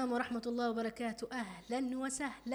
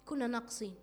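ordinary talk, nothing marked

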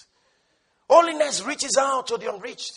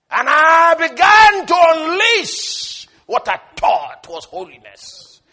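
loud, slow hellfire preaching; retold fiery preaching; 4 semitones higher, 8 dB louder, slower than usual. Preacher recalling how he yelled at a necking couple, loud and slow. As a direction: blast them with hellfire. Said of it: "I preached hell and holiness".

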